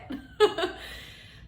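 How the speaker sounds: high breathy laugh. Real, not acted. After venting to the doctor, a high laugh. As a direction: laugh at her own rant.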